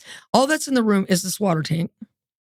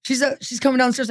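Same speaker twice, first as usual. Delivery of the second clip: usual speaking voice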